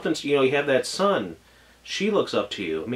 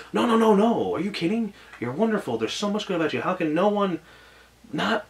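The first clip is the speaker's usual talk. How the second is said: eager protest; 7 semitones higher than usual. Retold or imitated. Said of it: "springs up instantly".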